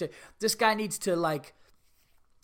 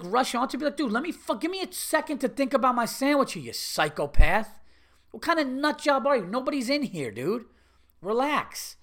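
high-pitched, incredulous telling-off; indignant customer losing patience; exasperated outburst; 7 semitones higher than usual. Acted. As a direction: rant at someone who is rushing you. Acted outburst at rushing sandwich worker.

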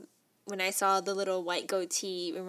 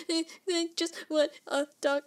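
higher, hiccuping short breaths; post-crying gasps; 8 semitones higher than usual. Shown as a demonstration. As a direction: imitate catching your breath after sobbing. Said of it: "doing all those short breaths".

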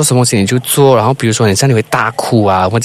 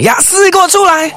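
much higher, yelled name; shouted summons. Shown as a demonstration. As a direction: call her out of hiding. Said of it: "这样你就大喊他的名字".

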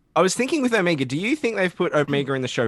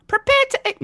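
much higher put-on voice; performed line; 4 dB louder than usual. [name] attempting the requested line, high voice. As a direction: try it in the character's voice. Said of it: "give [name] a line to read like that".

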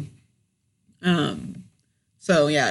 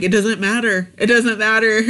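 louder, rising insistence; mock firm dismissal; 4 semitones higher, 5 dB louder than usual. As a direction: shut her argument down. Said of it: "I'm like".

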